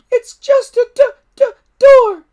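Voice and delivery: very high, loud squeaky dragon voice; disappointed little dragon. A narrator's reading. Toothless to Hiccup, squeaky and disappointed. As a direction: let the little dragon sag with letdown.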